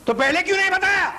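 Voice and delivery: high-pitched indignant retort. Acted. Flustered clerk blames customer for package.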